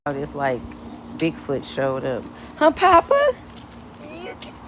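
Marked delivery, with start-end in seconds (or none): 2.6-4.7 jumps up into a squeaky falsetto. Real, not acted. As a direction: tease the shy dog in a tiny high voice. Teasing the shy dog.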